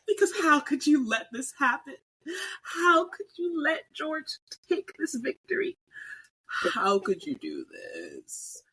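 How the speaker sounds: hammed-up wail, pitched high and drawn out; mock anguish. Fan wailing at Max over George's win, mock crying. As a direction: pretend heartbreak, crying out how could you.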